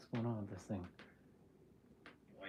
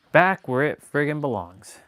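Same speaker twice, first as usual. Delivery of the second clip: loud triumphant; 3 semitones higher, 23 dB louder than usual. Real, not acted. Mic finally fixed, triumphant.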